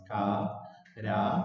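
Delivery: "Carátula" slowly split into ca, rá, tu, la; ca... rá... tu... la, dictating "Carátula"; syllable by syllable. Shown as a demonstration. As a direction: separate each syllable of "Carátula". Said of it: "Separemos en sílabas".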